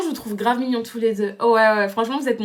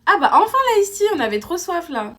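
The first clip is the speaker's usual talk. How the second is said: loud cover-up; 9 semitones higher, 5 dB louder than usual. Acted. Snaps back as friend returns.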